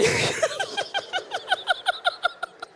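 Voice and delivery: breathy high-pitched giggle; high laugh. Real, not acted. Preacher cracking up at his own story, high laugh. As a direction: burst into a helpless laugh.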